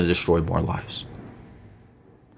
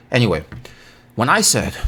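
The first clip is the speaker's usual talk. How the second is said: ordinary talk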